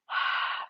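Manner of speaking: breathy fogging exhale, no voice; foggy exhale. Shown as a demonstration. Yoga teacher shows the fog breath, breathy exhale. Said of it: "exactly like if I was to fog a window".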